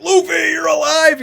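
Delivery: high, slow, joyful yell; Chopper shouting. Retold or imitated. Chopper yelling to Luffy, high and overjoyed. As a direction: cry out in relief at a friend back. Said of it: "Chopper comes up and he's like".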